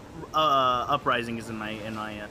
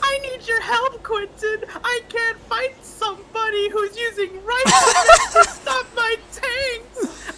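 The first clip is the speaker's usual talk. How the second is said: high, loud fake sobbing; mock crying plea; 4 dB louder than usual. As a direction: wail for help like a crybaby. Begging Quentin for help in war.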